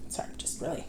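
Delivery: breathy worn-out whisper of exhaustion; tired whisper. Real, not acted. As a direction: trail off into an exhausted whisper on "really". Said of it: "just really out of it".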